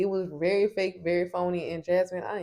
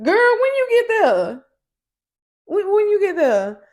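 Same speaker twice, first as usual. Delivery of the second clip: put-on much higher, louder girl; shrill fake surprise; 12 dB louder than usual. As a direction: squeal it in mock surprise. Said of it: "Jasmine's like".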